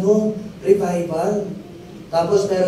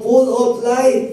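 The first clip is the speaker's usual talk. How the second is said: higher, louder, slower and bright with energy; full of life; 7 semitones higher, 4 dB louder, slower than usual. Shown as a demonstration. Preacher tells the congregation how to answer, loud and energetic. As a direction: say it like you mean it, full of joy. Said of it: "Sabi mo".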